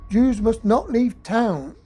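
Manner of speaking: normal talking voice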